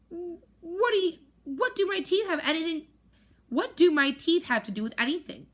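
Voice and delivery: high, halting, flustered question; nervous stammer. Acted. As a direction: stumble over it, caught off guard. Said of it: "looking around nervously".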